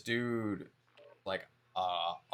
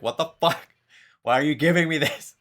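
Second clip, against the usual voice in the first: higher, louder mock outrage; retold outraged yell; 6 semitones higher, 9 dB louder than usual. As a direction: replay the shocked yell at a friend. Retold reaction to gross text.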